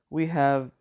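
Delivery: usual speaking voice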